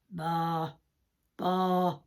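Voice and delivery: low sheep baas; imitation of a bleating sheep. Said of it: "the sheep came along".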